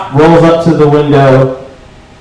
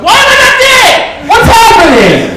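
shocked disbelief. Retold or imitated. Comic relieved he survived the stop, shocked and baffled. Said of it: "And I was like".

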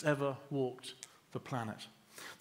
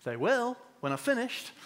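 put-on little girl; 8 semitones higher than usual. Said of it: "The little girl just smiled".

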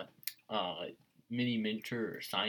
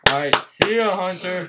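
game show host; 7 semitones higher, 12 dB louder than usual. Pretend. Mock game show sign-off.

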